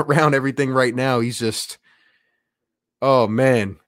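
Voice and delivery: ordinary talk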